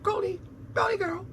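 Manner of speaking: very high, cooing pet call; high cat voice. Shown as a demonstration. Owner showing how he calls Goldie, very high coo. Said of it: "I talk to her in that high voice".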